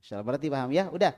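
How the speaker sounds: normal talking voice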